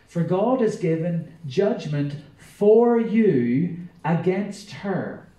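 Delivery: solemn pulpit reading; scripture reading. Acted. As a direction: read it out like a herald to the church. Reads Revelation aloud.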